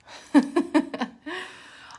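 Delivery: high, loud burst of giggles; laughing. Real, not acted. Host greeting online meditation group, laughing.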